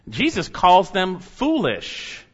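normal talking voice

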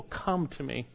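as if Jesus calls the weary to him; gentle invitation. Acted. Preacher voicing Jesus inviting the weary, gently.

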